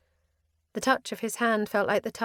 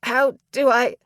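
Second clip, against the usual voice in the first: panicked half question, louder; choked out; 5 semitones higher, 7 dB louder than usual. A narrator's reading. Flyer choking out a plea mid-flight, panicked. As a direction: cut off mid-question in pain. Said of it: "I choked out".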